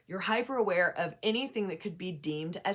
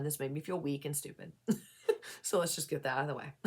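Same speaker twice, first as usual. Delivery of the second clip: usual speaking voice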